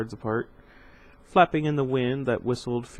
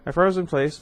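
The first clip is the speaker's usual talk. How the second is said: ordinary delivery, nothing marked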